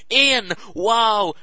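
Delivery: high, loud fake amazement; gushing wow. Fundraiser mishearing the teen's name, loud gushing wow. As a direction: act thrilled over a wrong name.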